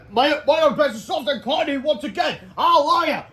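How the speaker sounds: high, loud jubilant singsong; hammed-up jubilation; rejoicing hero. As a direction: rejoice with cartoon joy, swelling to the last word.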